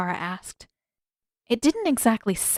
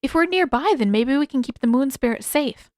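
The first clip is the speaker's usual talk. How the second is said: eager plea; 5 semitones higher, 4 dB louder than usual. A narrator's reading. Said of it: "Aang asked eagerly".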